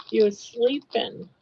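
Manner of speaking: ordinary talk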